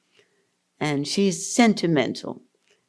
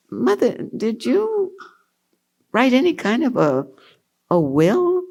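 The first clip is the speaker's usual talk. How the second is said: hesitant; 8 semitones higher than usual. Retold or imitated. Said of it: "said very hesitantly".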